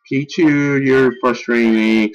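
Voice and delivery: ordinary talk, nothing marked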